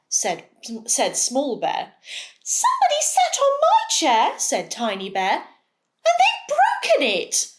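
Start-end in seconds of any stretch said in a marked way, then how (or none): 2.4-4.4 very high, squeaky little bear voice; tiny bear squeaks out his complaint. Acted. Tiny Bear finds his chair, high squeaky voice. 6.1-7.5 very high little bear voice, upset; a tiny cub wailing about his chair. Acted. Tiny Bear reports the broken chair, high squeak.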